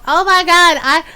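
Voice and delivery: hammed-up whining; whiny complainer. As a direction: imitate someone moaning about quarantine. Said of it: "they turn around and complain and go".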